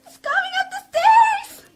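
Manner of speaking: terrified wife hearing someone climb the stairs; sobbing terror. Acted. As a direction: gasp it out through panicked tears. Sobbing terror as intruder approaches.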